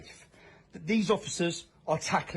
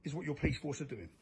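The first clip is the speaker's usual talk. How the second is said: ordinary talk, nothing marked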